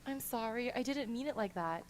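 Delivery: soft, small apologetic voice. A narrator's reading. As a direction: say it quietly, like a shy apology. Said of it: "says in a small voice".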